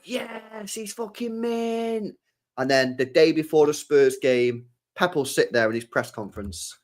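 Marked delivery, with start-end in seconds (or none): 0.0-2.2 imitating fans. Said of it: "it'll be like".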